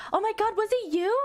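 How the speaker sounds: high mock squeal; excited girls. Classmates asking the popular girl, squealing.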